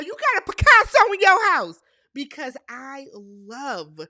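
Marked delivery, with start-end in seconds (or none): 0.0-1.8 high, very loud movie-quote holler; Esquire from ATL yell. Retold or imitated. Fan quoting Esquire from ATL, yelling. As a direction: yell it out like an excited film character. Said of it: "I am known to yell".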